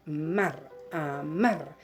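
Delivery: "mar" alone, then "amar" stressed on MAR; stress on last syllable. Shown as a demonstration. Teacher showing where the voice falls, "amar" with stressed, drawn-out MAR. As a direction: lean on the final syllable of "amar". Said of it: "Dónde lleva la fuerza de voz".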